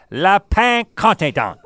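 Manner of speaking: slow, high mangled French; mock French. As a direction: ham up the French name. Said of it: "so they don't have to say".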